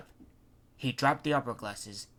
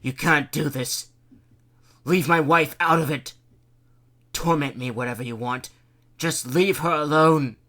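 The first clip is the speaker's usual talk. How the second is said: louder, pleading for his wife; desperate plea; 3 semitones higher, 11 dB louder than usual. A narrator's reading. Mayor pleading loudly to protect his wife. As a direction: beg her to spare his wife. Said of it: "he pleaded".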